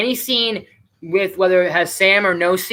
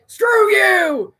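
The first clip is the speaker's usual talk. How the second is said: high, drawn-out imitated yell; mock shout; 3 dB louder than usual. Old man rebuffing the neighbor's apology, in a high mock yell. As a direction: holler it like a cranky old neighbor. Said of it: "And he's just like".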